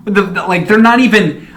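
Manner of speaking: usual speaking voice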